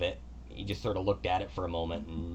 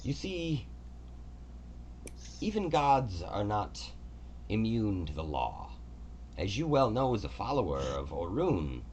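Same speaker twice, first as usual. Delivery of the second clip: god Kelemvor; 3 semitones higher than usual. Acted. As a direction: speak as a gracious, orderly god. Kelemvor's long explanation.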